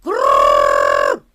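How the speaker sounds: long loud rolled krrr, very low and rasping; rooster crow rattle. Acted. Imitating the missing rooster, a loud rolled krrr.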